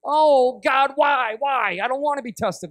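a mock protest against being tested; hammed-up self-pity; whiny complaint. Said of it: "He said / freak out".

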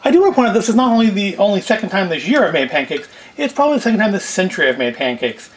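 ordinary talk, nothing marked

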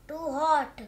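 plain reading voice

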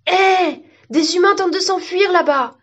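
high-pitched alarm call; crying out. A narrator's reading. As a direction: call out to point at escapees. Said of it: "des arbres s'écrièrent".

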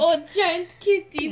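put-on Russian accent. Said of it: "Why are we all talking in Russian accents?".

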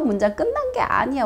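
ordinary delivery

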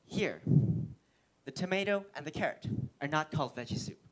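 ordinary talk

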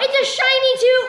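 excited. Real, not acted. Excited reveal.

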